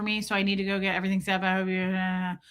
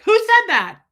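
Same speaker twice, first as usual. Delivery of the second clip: much higher, louder, alarmed cartoon voice; a startled little creature calling out; panicked squeaky character; voicing a lonely boob; 10 semitones higher, 8 dB louder than usual. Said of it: "this one's like".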